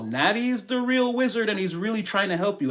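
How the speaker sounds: normal talking voice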